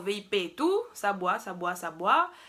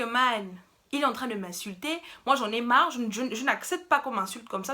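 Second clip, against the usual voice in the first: ordinary delivery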